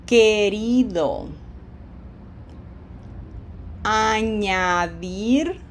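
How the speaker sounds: slow, stretched Spanish verb forms; measured repeat-after-me modelling; slow pronunciation drill. Shown as a demonstration. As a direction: draw out each vowel for learners to copy. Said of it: "saying these things out loud with me".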